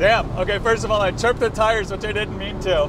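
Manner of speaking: loud, high and thrilled; buzzing with adrenaline; excited. Real, not acted. Chirped tires, excited.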